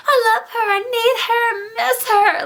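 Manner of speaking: high whiny lovesick pleading; mock lovesick prince. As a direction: whine it like a lovesick boy.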